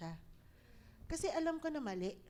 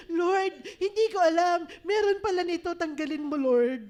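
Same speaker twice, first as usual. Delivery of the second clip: loud, high plea with a tearful edge; loud surrendering prayer; 5 semitones higher, 11 dB louder than usual. Acted. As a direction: pour it out, crying to God. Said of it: "So, isusuko". (malakas na umiiyak na panalangin)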